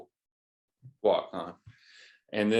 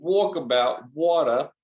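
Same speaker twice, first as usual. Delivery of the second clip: louder, higher hammed-up Australian Walkabout, water; joke Australian accent; 5 semitones higher, 6 dB louder than usual. Joking with the host in a mock Australian accent. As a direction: lean into a silly Australian twang. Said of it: "I don't think that's a good accent".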